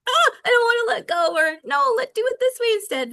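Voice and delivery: high, loud control-freak protest; hammed-up fretting at letting go; mock panic. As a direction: cry out in exaggerated, high-pitched reluctance. Acts out own control issues.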